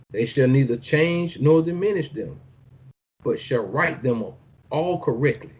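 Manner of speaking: measured preacher's reading; scripture reading. Acted. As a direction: declaim each line like a decree. Reads scripture aloud.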